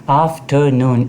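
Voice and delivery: Afternoon slowly split as af-ter-noon; syllable by syllable. Shown as a demonstration. Teacher showing three syllables, word broken into beats. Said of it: "इसके अंदर तीन syllable है".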